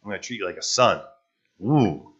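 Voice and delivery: usual speaking voice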